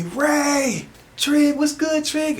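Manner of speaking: very high, loud mock greeting; high-pitched shouted hail; excited calling out. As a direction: imitate fans hollering hello in a squeal. Fans calling out greetings to him.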